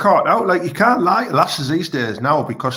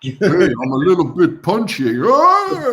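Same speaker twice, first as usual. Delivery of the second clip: imitating Rocky; 4 dB louder, slower than usual.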